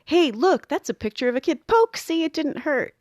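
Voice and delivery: high, mock-cheery trick voice; hammed-up nurse poking the patient; sneaky distraction. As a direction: fake cheer while you jab. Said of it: "But that's different than".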